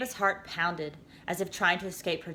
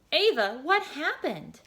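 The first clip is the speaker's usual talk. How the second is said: slow higher concern; 9 semitones higher, 3 dB louder, slower than usual. A narrator's reading. Voice asking the girl, slow and higher. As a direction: ask it gently. The gentle voice returns.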